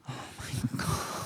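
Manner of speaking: slow, gasping whisper; breathy whisper. Retold or imitated. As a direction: whisper it in awe. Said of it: "and she'd be like".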